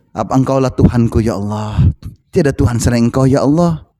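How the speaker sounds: low, subdued supplication; meek, low-pitched prayer; humble prayer demonstration. Shown as a demonstration. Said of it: "coba minta".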